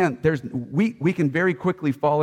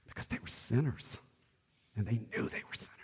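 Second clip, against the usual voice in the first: hushed; 13 dB quieter, faster than usual. Real, not acted. Preacher hushing the room about the disciples' sin, near whisper. Sinners ashamed before holy God.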